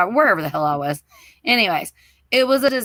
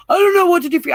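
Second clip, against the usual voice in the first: husband's helpless answer; 8 semitones higher, 5 dB louder, much faster than usual. Retold or imitated. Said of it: "He's like".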